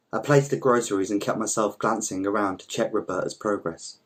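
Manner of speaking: ordinary delivery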